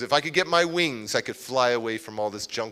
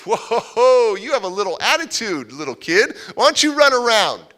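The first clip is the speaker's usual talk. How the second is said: louder, higher playful challenge; amused man egging on a cheeky boy; teasing foster dad; 8 semitones higher, 9 dB louder than usual. Retold or imitated. Foster dad teasing angry kid.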